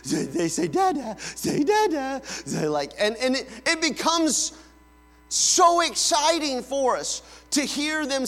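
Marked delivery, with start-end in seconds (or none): 0.0-2.2 high, squeaky, overcome with joy; giddy new dad. Acted. Dad hearing Dada for the first time, squealing giddy. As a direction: act out a dad melting at his baby's first word.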